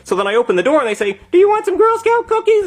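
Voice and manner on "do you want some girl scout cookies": very high little-girl imitation; Girl Scout. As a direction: pipe it up like a child selling cookies. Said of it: "It's just some Girl Scouts".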